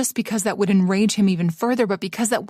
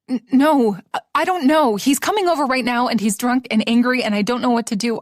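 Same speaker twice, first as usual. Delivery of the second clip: scared rambling; 3 semitones higher than usual. Acted. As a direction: blurt it out in panic. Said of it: "she rambled".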